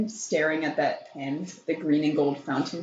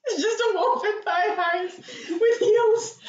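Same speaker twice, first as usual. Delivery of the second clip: high, helpless laughter through the words; real giggles breaking into the sentence; laughing; 14 semitones higher, 3 dB louder than usual. Real, not acted. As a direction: crack up while saying it, voice shooting up. Cracking up at the wolf image.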